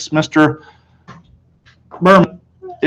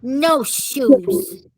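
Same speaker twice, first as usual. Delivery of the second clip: higher, stubborn refusal; toddler voice; 11 semitones higher, 6 dB quieter than usual. A narrator's reading. Fudge refusing shoes, higher. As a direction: pout it out. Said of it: "Fudge said".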